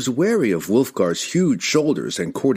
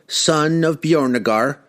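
slow, higher, firm address; firm elder; 7 semitones higher than usual. Acted. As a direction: address him firmly as an elder. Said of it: "Revjak said firmly".

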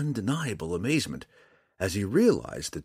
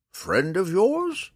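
put-on high, slow, unhurried old man voice; calm old man; 13 semitones higher, 5 dB louder, much slower than usual. A narrator's reading. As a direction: ask it calmly in the old hermit's voice. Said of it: "the old man asked calmly".

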